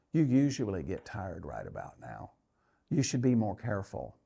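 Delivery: a hint of a low, sly doubting voice in my head; doubting inner demon. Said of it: "the next demon shows up".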